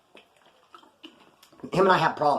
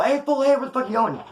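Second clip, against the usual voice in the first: retold yell; 9 semitones higher, 3 dB louder than usual. Both yelling after fleeing friend.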